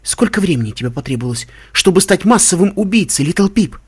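accusation climbing high and loud; rising, damning reproach; condemning. A narrator's reading. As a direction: start level, then hurl the charge. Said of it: "Её осуждающий тон".

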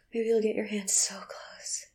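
ordinary talk

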